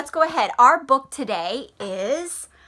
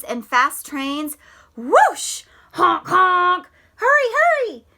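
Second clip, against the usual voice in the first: story reading. Acted. Reads picture book aloud.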